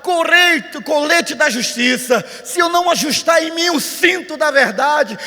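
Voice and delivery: high, loud, driving sermon delivery; full-force preaching. Real, not acted. Preacher urging believers into God's armor, full-force preaching. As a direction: thunder out the list to the congregation.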